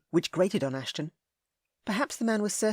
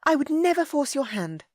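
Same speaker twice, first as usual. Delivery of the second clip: high, loud proud lady; Ellie, haughty; 10 semitones higher, 5 dB louder than usual. A narrator's reading. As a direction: declare it with her chin up. Ellie retort.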